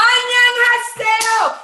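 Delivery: very high, loud, slow Annyeonghaseyo; delighted dry cleaner. Retold or imitated. The dry cleaner answering her, a very high delighted Annyeonghaseyo. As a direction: squeal Annyeonghaseyo back in delight. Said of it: "he's like".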